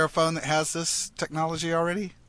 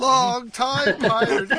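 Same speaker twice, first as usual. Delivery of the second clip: high, slow, laughing put-on voice; laughing pirate voice; 8 semitones higher, slower than usual. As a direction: giggle through a hammed-up pirate.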